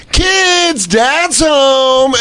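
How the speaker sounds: high, drawn-out sing-out call; mock happy dad announcing himself; cheery dad. Mocking a troll as family man, cheery dad calling out.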